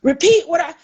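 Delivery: a mock exasperated mother; annoyed. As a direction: replay your own irritation at him. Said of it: "I would find myself getting annoyed".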